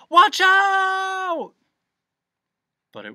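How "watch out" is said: long, high, very loud yell; yelled warning. A narrator's reading. Milo warning of the coming crash, yelled. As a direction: scream it out as urgent warning. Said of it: "and then he yelled".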